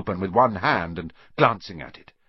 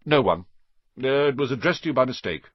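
curt; 5 semitones higher, 4 dB louder, faster than usual. A narrator's reading. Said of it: "said Uncle Vernon shortly".

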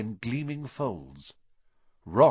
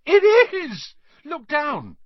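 much higher, much louder amazed shout; shrieking in wonder; excited yell; 11 dB louder, slower than usual. Acted. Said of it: "Ron gave a yell".

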